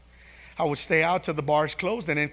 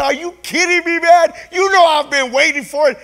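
high, loud acted outburst; excited disbelief; 11 semitones higher, 12 dB louder than usual. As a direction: shout it back, thrilled and incredulous. Acted excited answer to job offer.

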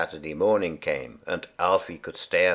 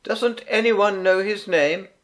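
higher, carrying call to the room; a helper calling over the noise; 11 semitones higher than usual. A narrator's reading. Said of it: "cried one helper".

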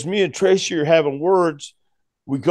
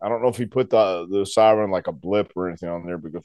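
ordinary talk

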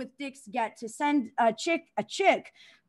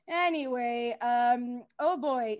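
normal talking voice

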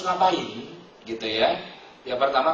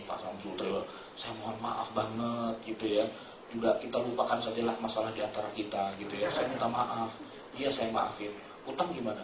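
hushed apology; 5 semitones lower, 10 dB quieter than usual. Retold or imitated. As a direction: murmur the sorry like asking a favour. Apology scene met on bridge.

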